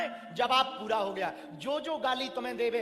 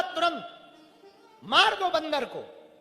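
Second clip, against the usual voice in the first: louder, higher, slow command to kill; furious order; 5 semitones higher, 6 dB louder, slower than usual. Acted. Ravana angrily commands his guards to kill the monkey.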